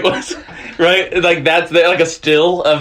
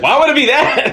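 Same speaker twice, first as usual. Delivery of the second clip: incredulous coworker; 9 semitones higher than usual. Retold or imitated. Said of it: "they're like".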